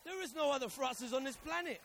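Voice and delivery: high, loud praise of Francis; preaching full out; loud proclamation. Real, not acted. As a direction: shout out his wife's uniqueness. Preacher praising his wife.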